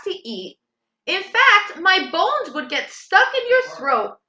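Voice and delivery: high, eager goat voice arguing his case; a goat coolly talking the troll out of eating him; middle goat bargaining. Acted. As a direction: persuade the troll he's too bony.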